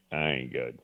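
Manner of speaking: dropped low and quiet, voice catching as it gives way; choked up. Retold or imitated. Host admits to co-hosts he fell apart, choked up and quiet. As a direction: let the admission fall out almost unheard, choked. Retelling breaking down on air.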